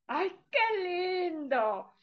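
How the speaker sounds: slow, tender near-tearful "¡Ay, qué lindo!"; touched. Real, not acted. Telling children a story, slow and tenderly moved. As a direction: let it melt, drawn out and moved.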